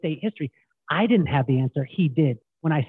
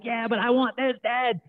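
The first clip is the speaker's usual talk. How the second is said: put-on whiny teen protest; 7 semitones higher than usual. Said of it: "I used to get so mad".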